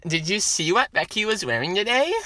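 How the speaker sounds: put-on mean, bratty girl gossip; annoying girl voice. Gossiping to a friend about Becky, bratty girl voice. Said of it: "that was my annoying girl voice".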